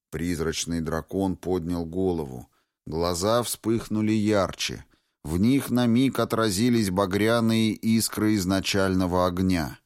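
usual speaking voice

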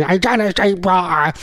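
hammed-up caricature voice; Janet Street Porter. Radio host demonstrating an impression on air, caricature voice. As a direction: do a short, exaggerated celebrity impression. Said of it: "do my best to do an impression".

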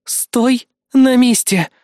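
high, slow, emphatic order; forceful command. Acted. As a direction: halt them with each word stretched and firm. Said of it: "куда убедительнее". (медленный властный приказ высоким голосом)

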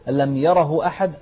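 "ألم يره أحد" بسرعة دون إشباع الهاء; اقرأها بسرعة كمثال للخطأ; قراءة سريعة خاطئة. Shown as a demonstration. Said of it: "سريعة لا". (مثال خاطئ بسرعة)

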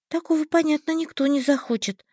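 put-on high, innocent girl; young girl voice. A narrator's reading. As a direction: play the naive orphan girl. Heroine's voice, high.

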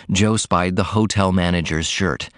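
usual speaking voice

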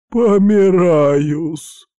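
high, loud, long-drawn dying cry; agonized wail. Acted. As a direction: wail it slowly as if expiring. Doctor hit in the groin, collapses. (протяжный предсмертный вопль)

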